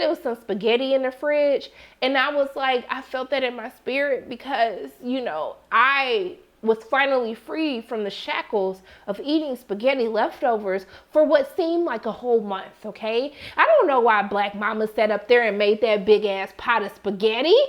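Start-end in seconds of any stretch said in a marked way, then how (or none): none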